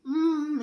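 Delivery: high, loud mmm of pleasure; loud mmm of delight. Real, not acted. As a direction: hum with delight at the taste. Tasting own calzone.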